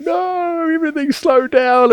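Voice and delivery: very high, awed, stretched-out voice; stoned friend. Retold or imitated. Stoned friend in the car marvelling at slow motion, high-pitched awe. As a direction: mimic a stoned friend amazed the world slowed. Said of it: "he's sitting beside me going".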